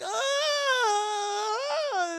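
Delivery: long, high, loud group wail; mimicked wail. Storyteller voicing villagers echoing old man, mimicked wailing. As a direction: hold one mock howl, copying someone's agony. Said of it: "mimicking his, his wails".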